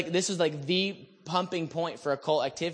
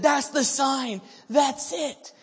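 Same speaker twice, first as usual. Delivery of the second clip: high, raised cry of recognition; excited declaration; 9 semitones higher, 3 dB louder, much slower than usual. Retold or imitated. Spotting a sign across the street, excited declaration. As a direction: call it out as sudden proof.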